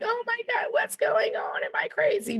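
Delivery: high, breathy panicked whisper; high startled whisper; mock panicked teen. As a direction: squeak it out like a confused teen. Confused teen's inner panic.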